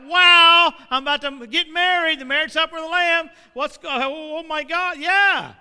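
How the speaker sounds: loud, high, stunned churchgoer; mock startled excitement. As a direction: blurt it out like caught off guard at the altar. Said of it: "we're like".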